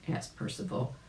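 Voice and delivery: ordinary delivery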